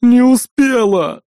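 slow furious roar. A narrator's reading. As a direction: roar it out in frustration. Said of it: "взревела та от обиды". (обиженный рёв)

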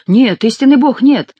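loud, high cry of no; exclaiming. Acted. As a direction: burst out refusing. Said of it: "воскликнул Сен-Люк". (громкий высокий возглас)

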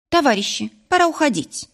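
sharp rising call, pitch jumps high on «уходить»; nurse snapping. A narrator's reading. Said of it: "прикрикнула медсестра". (медсестра строго прикрикивает, голос взлетает вверх)

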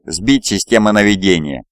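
ordinary delivery

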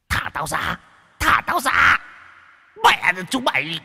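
high shrieking ghost voice; screaming trapped ghost. A narrator's reading. Trapped ghost shrieks at the exorcists to be freed. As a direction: shriek to be let out.

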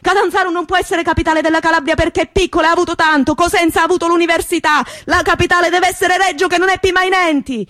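high, impassioned shouting orator; fiery rally speech. Retold or imitated. Mayor rallying thousands of Reggini, high impassioned shouting. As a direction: rouse the whole square to fight. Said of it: "dava battaglia nella piazza".

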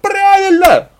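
very high, loud yell; piercingly high female cry; woman's shout of support. A narrator's reading. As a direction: shriek agreement from the crowd. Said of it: "поддержал другой женский голос".

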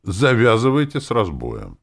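loud, high, stern warning; firm reprimand; strict. A narrator's reading. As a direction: lay down the law loudly. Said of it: "сделал я строгое лицо".

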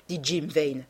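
plain reading voice

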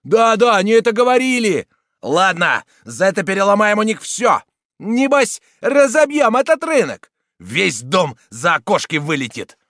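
loud, high threats; mob shouting. A narrator's reading. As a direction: yell it as one of an angry mob.

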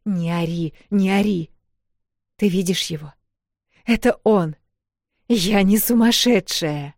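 agitated. Acted. Sisters see the ghost.

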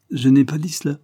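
loud, blunt, candid outburst; frank exclamation. A narrator's reading. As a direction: blurt it out frankly. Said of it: "s'écria-t-il avec sa franchise habituelle".

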